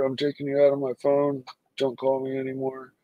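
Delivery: ordinary delivery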